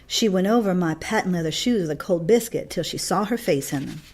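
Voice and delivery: ordinary delivery, nothing marked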